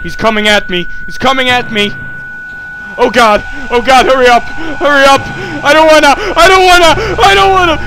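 loud, high, frantic repeating; real rising panic. Real, not acted. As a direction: shout in mounting terror as it closes in. Panics as creature attacks.

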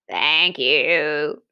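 low goat voice with a bleating bah. A narrator's reading. Goat telling the giant its sail blew away. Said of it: "said the goat".

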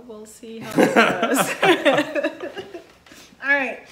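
slow, laughing, tipsy Southern drawl. Real, not acted. After teasing about her drinking, slow laughing tipsy drawl. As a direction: drawl it out slowly through a laugh. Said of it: "my Southern drawl comes out when I drink".

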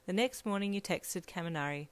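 ordinary delivery, nothing marked

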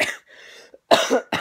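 harsh unvoiced cough; loud sudden cough. Real, not acted. Coughing fit mid-sentence.

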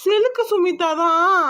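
high, slow, drawn-out amazement; bewildered shock. Acted. Schemer finding his evidence photo swapped, bewildered shock. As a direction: stare at it, stretching each word in disbelief.

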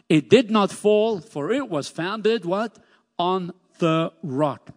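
"did" hit high and loud, then a rising "what?"; slow, weighted "On the rock"; emphatic call and answer. Shown as a demonstration. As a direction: make them finish the line. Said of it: "call and response repeat".

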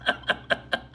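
airy high laugh; quick unvoiced laugh; breathy laugh. Real, not acted. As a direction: laugh out the punchline. Laughs after punchline.

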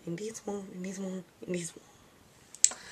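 soft airy weird voice. Shown as a demonstration. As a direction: drop to a strange near-whisper. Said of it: "Sorry if I, like, talk in weird voices".

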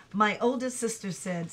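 usual speaking voice

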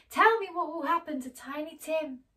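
high, loud urgent plea; pleading Scrooge. Acted. Scrooge pleading with the silent phantom, loud. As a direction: demand an answer, frightened. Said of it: "Scrooge pleads with phantom".

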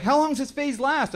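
wife worried about their son. Retold or imitated. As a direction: ask it like a fretting mother.

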